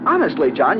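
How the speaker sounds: ordinary delivery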